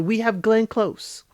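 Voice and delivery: usual speaking voice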